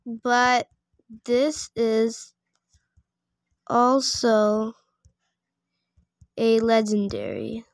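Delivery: word by word, long pauses between; slow dictation. Real, not acted. Player typing a reply about a legendary pet, slow dictation. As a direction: sound it out slowly while typing. Sounding out a typed message.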